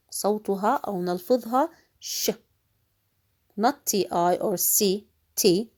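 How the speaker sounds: ordinary delivery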